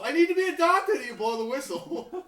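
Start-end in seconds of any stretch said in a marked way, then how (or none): 0.0-1.0 mock plea. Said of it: "blowing the whistle".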